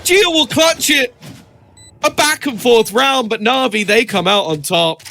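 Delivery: high excited yell for the clutch; esports caster shout. Real, not acted. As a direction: explode as the clutch lands. Clutch won, caster erupts.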